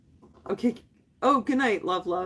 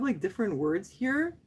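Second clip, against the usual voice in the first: ordinary talk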